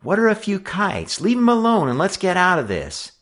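very high, voiced confiding plea; thin high aside, not truly hushed; urgent stage whisper. A narrator's reading. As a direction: coax a friend to back off. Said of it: "Charlie whispered in Joe's ear".

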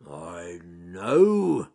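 growling bear. Acted. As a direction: growl the idea out. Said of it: "he growled".

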